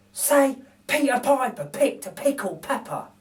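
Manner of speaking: lightly voiced thief teaching; coaxing burglar. Narrator voices Bill teaching the baby to talk.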